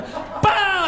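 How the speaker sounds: high, explosive kick sound; sudden vocal crash effect; imitated impact. Pursuer kicks off the side mirror, imitated impact.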